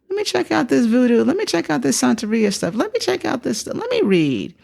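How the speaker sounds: high, repetitive retold self-urging; excitedly talking yourself into it; eager curiosity. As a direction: rattle off each idea in a high, eager voice. Retold eager searching through options.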